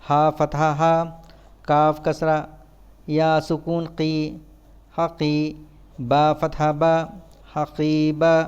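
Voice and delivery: even, monotone syllable-by-syllable drill; measured classroom letter drill; spelling drill recitation. Shown as a demonstration. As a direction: recite it like a spelling chant, pausing between items.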